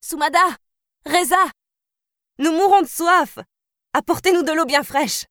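put-on high, carrying call of a young princess over the noise. A narrator's reading. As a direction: call across the hall to the servants, pitched high. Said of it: "la voix de la princesse s'éleva soudain".

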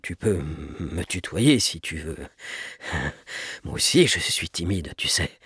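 low, gentle, halting confiding; tender low tone with an awkward little laugh; softest voice. A narrator's reading. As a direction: put a shy child at ease in a low voice. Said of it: "de sa voix la plus douce".

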